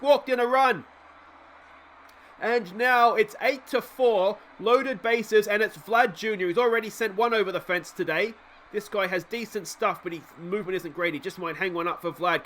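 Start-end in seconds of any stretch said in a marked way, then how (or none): none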